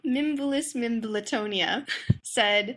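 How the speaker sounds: ordinary talk, nothing marked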